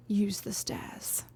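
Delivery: slow, breathy whisper of a dry warning; whispered aside. Acted. The Baron slyly warns Will off the window, whispered slow. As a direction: lean in and whisper it slowly.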